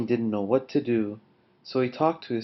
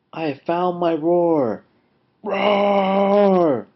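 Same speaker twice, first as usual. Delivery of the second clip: high, loud cry building to a roar; triumphant roar; 6 semitones higher, 7 dB louder, much slower than usual. Acted. Lion has found his roar, roaring triumphantly. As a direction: burst into a long lion roar.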